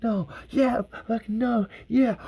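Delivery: high-pitched mock suspense reactions; excited viewer. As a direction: swing between dread and cheering, pitched high. Acting out viewer during close calls.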